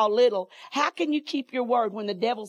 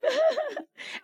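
high laugh; 3 dB quieter than usual. Real, not acted. Laughs after excited outburst.

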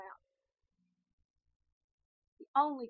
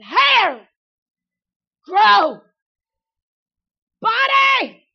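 retold rebuke shouted at full force, much louder and higher; commanding shout; 11 semitones higher, 23 dB louder, much slower than usual. Preacher retells commanding her hair and body to heal, shouting. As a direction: bark out commands to the demons.

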